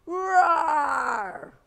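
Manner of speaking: big, loud roar trailing downward; bear roar. A narrator's reading. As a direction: roar at full volume, long. Bear stomps to girl's house.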